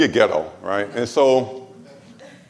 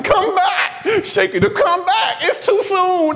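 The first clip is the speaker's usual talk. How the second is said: high, hammed-up anguished wail; wailing mourner; slower than usual. Mourner in the casket begging the dead man, hammed-up wail. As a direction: imitate a mourner pleading with the dead.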